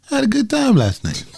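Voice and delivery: high sugary reassuring tone; sing-song fake sweetness; sweet fake text voice. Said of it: "comforting text, usually a lie".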